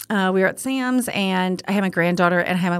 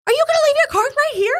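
imitating a furious stranger, high and loud; fast, high, mock-furious question; mock angry shout; 5 dB louder, faster than usual. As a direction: yell it like an irate man over a cart. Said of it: "he's like".

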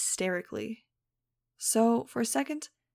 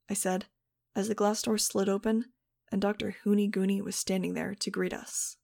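ordinary delivery

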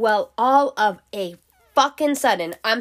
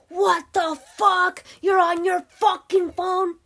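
high, slow mock yell of an angry man; angry man's yell; 11 semitones higher, slower than usual. Scooter man yelling at the driver, high mock angry shout.

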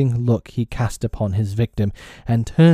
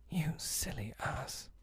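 slow, tender whisper; 4 semitones higher, 20 dB quieter, slower than usual. A narrator's reading. Dying fairy into Peter's ear, slow fond whisper. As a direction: breathe it slowly right into the ear. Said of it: "She whispered in his ear".

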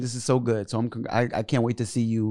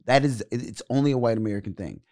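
ordinary talk, nothing marked

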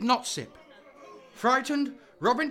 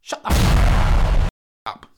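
angry outburst; 12 semitones lower, 13 dB louder, much slower than usual. Acted. Angry retort to the heckler.

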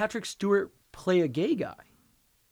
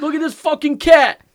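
loud high New York shout; New York accent, yelled; 10 semitones higher, 12 dB louder than usual. Retold or imitated. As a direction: yell it like the cat meme guy from New York. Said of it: "That meme of the cat".

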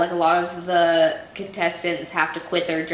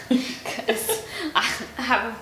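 laughing to keep from crying; holding back tears; 6 semitones higher, 3 dB quieter, much slower than usual. Real, not acted. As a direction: voice breaking into a teary laugh. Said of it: "I'm trying not to cry".

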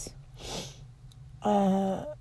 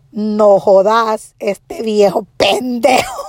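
loud, drawn-out Spanish swearing; retold angry outburst; 4 dB louder, slower than usual. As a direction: act out furious exasperation in Spanish. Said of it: "I was so angry".

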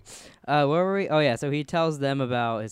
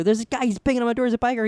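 high, loud and fast; retold panic; 10 semitones higher, 6 dB louder, faster than usual. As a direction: gabble it out in a panic. Panicked phone call repeated.

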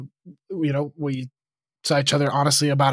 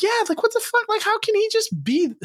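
high-pitched incredulous rant; retold indignation. Venting to a friend about the roommate's boyfriend, high-pitched indignation. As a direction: replay the outrage with pitch shooting way up.

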